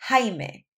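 "Jaime" begun with an H sound, like "HAI-meh"; J pronounced as H. Shown as a demonstration. Teacher giving dad's name as example, H sound in "Jaime". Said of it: "pronounced like an H sound".

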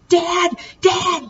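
very high, loud shout; boy shouting for help; crying shout. A narrator's reading. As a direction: shout desperately. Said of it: "Burke cried".

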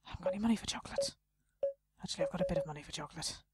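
quick, soft self-talk; rushed. Real, not acted. Gamer thinking aloud about money, rushed and soft. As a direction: rattle it off under your breath. Rushing through thoughts.

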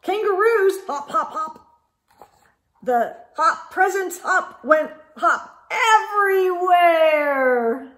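put-on lively read-aloud for kids; animated storybook reading; story narration. A narrator's reading. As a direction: read it like a picture book.

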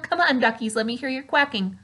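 normal talking voice

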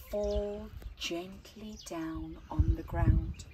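ordinary delivery, nothing marked

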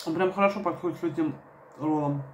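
chewing while talking; eating a roll; mouth partly full. Real, not acted. Seen 0: Bit roll at -4; holds it, lips closed and working between phrases.

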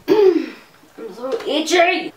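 loud, high, drawn-out complaint; itchy whine. Real, not acted. Vlogger complaining of an itch between takes, drawn-out high whine. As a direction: stretch it out slowly, high and loud.

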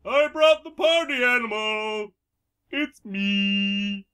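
party animal voice. Acted. Acting the party animal line.

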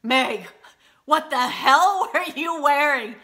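slow, loud, half-laughing disbelief; incredulous friend. Retold or imitated. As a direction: drag it out, shocked and amused. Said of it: "she's like".

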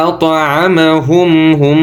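"আত'আমাহুম" chanted with the final m held in a nasal hum; slow "আত'আমাহুম", long nasal ending; ghunna. Shown as a demonstration. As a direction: hum and hold the m of "হুম". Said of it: "ওয়াজিব গুণা".

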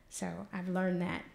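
normal talking voice

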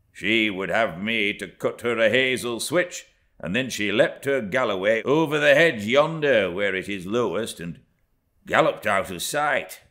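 lightly voiced country labourer; a hint of a rough farmhand. A narrator's reading.

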